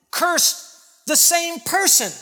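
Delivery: ordinary talk, nothing marked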